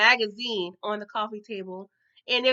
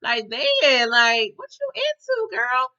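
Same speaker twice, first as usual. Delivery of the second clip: incredulous; 5 dB louder, slower than usual. Retold or imitated. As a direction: stretch the question out in shocked judgement. Incredulous at friend's behaviour.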